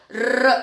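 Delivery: र as a long rolled "rrr"; pure tongue-tip trill on र; vowelless r. Shown as a demonstration. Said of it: "दूसरा रूप होता है".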